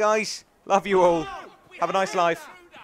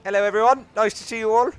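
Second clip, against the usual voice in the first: loud, higher, beaming hello to the crowd; loud greeting; 6 semitones higher, 9 dB louder than usual. Pretend. Player greets the in-game crowd, loud and bright. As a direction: call it out to everyone like a host.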